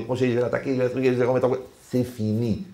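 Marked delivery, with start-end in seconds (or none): none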